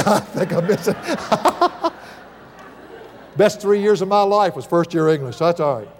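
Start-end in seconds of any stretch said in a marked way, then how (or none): 0.0-1.0 amused at yourself, breaking into laughs; laughing. Real, not acted. Preacher doubting his own spelling, laughing. 1.0-1.9 high-pitched burst of real laughter; helpless laugh at your own slip. Real, not acted. Preacher tickled by his own spelling, high laugh.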